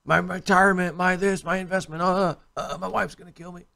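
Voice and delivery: high, whiny panicking investor voice; panicked investor. Retold or imitated. Mocking a panicked investor who fears his wife. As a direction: wail like a man losing his savings. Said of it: "they had us puking on the streets".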